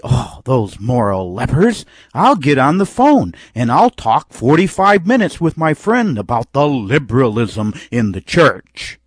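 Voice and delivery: loud, mock sanctimonious outrage; self-righteous indignation. Preacher mimics a self-righteous gossip deploring moral lepers, mock indignation. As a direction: put on a pompous, scandalized complaint. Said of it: "pull up the robes of self-righteousness".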